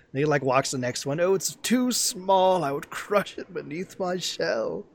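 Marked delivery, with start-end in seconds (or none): 1.2-4.9 high, slow, fretful turtle voice; a nervous giant turtle shying away from horses; timid old turtle. Retold or imitated. Imitating the turtle for friends, high and scared. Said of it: "the mannerism".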